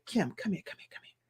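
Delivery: barely-there whisper, imitating a weak father; dying whisper. Dying dad calling his daughter to his bedside, imitated whisper. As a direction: summon someone with almost no voice left. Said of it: "he was whispering".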